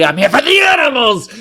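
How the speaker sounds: loud, high shout of defiance; defiant shout. Retold or imitated. Protester yelling back at the owner, loud and high. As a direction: declare it to the whole street.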